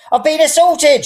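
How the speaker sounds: high, loud outraged shriek; shout. A narrator's reading. Old woman falls and shrieks she was assaulted, high and loud. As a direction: cry out as if attacked. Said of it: "she shouted".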